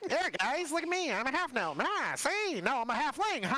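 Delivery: chirpy small halfling voice. Acted. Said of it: "I know halfling voice now".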